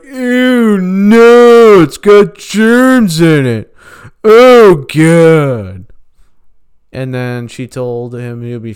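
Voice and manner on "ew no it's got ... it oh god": high, loud, grossed-out squeal; disgusted squealer voice. Retold or imitated. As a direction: shriek in disgust like a germaphobe. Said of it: "he was like".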